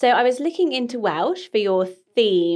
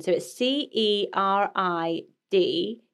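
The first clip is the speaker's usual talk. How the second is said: ordinary delivery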